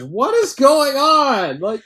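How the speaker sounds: high, loud, drawn-out disbelief; utterly baffled exclamation; incredulous outburst. Real, not acted. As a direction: cry out in bafflement, stretching the words. Baffled outburst at absurd plot turn.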